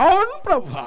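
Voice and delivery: mocking a hanger-on who agrees with every prayer, high squeak; squeaky mock amen. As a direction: chirp the amen in a high put-on voice. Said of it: "డక్కీలు అందరూ ఏం చేస్తాయి?".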